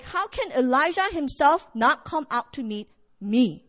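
normal talking voice

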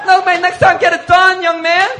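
high, loud exasperated retort; going off, shouting. Retold or imitated. Pastor brushing off Marcus's apology, loud scolding. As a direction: shout him down in mock outrage. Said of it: "I just go off on Marcus".

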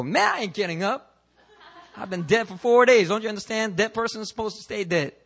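a hint of a grumbling corpse; reluctant Lazarus. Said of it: "he didn't go".

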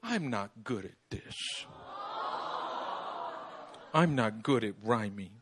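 mild mock sulk; frustrated child. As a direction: play a discouraged little girl. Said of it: "she gets frustrated and goes".

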